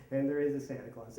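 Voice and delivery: usual speaking voice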